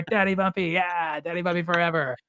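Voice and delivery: high, excited chanting dad; super animated. Retold or imitated. Dad cheering his son out to the car, super animated. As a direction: keep the kid-show hype rolling. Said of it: "super animated".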